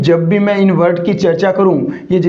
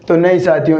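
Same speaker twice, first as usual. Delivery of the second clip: ordinary talk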